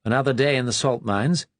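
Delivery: a lightly voiced upper-class English drawl; a hint of posh English drawl. A narrator's reading. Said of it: "Brightman had adopted an upper-class drawl".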